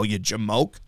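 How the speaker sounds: ordinary talk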